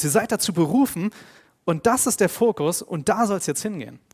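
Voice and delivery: loud, driving pulpit voice; preaching at full force. Acted. Preacher urging the congregation toward holiness, loud and driving. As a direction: preach it out to the whole church.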